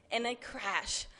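ordinary delivery, nothing marked

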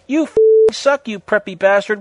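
loud, high mock outrage; acted angry insult. A narrator's reading. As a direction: hurl it out with overacted anger. Acted quarrel in story.

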